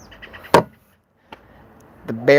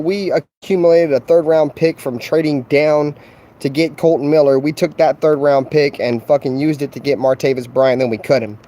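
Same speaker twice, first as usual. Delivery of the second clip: ordinary talk, nothing marked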